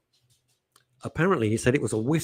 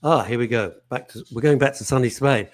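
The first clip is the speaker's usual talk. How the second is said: usual speaking voice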